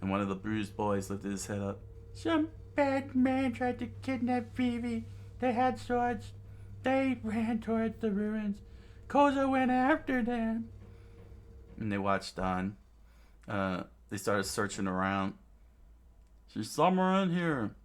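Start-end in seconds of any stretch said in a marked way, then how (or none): 2.2-10.7 put-on very high falsetto child voice; bruised little boy. Bruised boy telling the adult about kidnappers, squeaky. 16.5-17.8 high, urgent yell; searcher's call. Acted. Searcher hunting for the girl in ruins, urgent. First take, before correcting to bandits.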